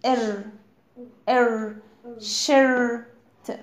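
"ار" as a held "er", repeated, then "shirt"; IR sound drilled. Shown as a demonstration. Teacher showing how I R is pronounced, held "er" then "shirt". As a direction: draw out "er" alone, pause, then stretch it inside "shirt". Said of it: "ال اي ار بتتنطق ازاي؟".

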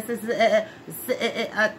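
stuttering over sis, eh, eh; mock stutter. Mocking people who stammer on her name, mock stutter. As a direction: imitate someone tripping over her name. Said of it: "was they stuttering".

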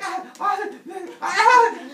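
repeated loud high shrieks; shrill mock panic, over and over; freaking-out screams. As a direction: scream in hammed-up hysterics. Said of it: "wife freaking out thing".

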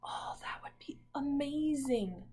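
soft whispered aside; hushed delighted whisper. Real, not acted. Teacher's hushed aside to class.

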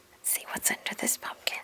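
whispered, conspiratorial invitation; hushed curiosity. A narrator's reading. Reader inviting children to look under a pumpkin, whispering. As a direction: whisper as you peek underneath.

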